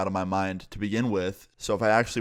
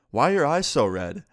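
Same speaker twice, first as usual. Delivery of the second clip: raised, higher-pitched teacher's challenge; imitated questioning teacher; 6 semitones higher, 4 dB louder than usual. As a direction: put the student on the spot, imitating a teacher. Teacher confronts red-eyed student in class.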